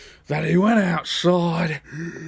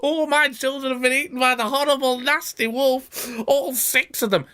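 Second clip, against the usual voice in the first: mock wailing; 9 semitones higher than usual. Mother goat wailing.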